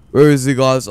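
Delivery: anxious loud call. Real, not acted. Searching in a scary game, louder.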